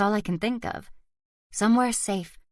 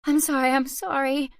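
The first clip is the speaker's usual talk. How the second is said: higher, tearful repeated sorry; upset mother's sorry; crying apology; 7 semitones higher than usual. A narrator's reading. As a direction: apologise through tears. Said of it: "I cry".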